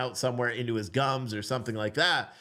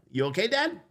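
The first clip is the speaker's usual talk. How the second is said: little son; 8 semitones higher than usual. Retold or imitated. Dad voicing his young son.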